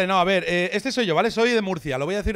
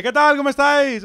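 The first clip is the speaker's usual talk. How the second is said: loud, high hype-up greeting to a crowd; re-enacted stage hello, pitched up and loud; rousing greeting; 6 semitones higher, 6 dB louder than usual. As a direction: call out to pump up the audience. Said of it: "uno espera ánimo y respuesta cuando dice".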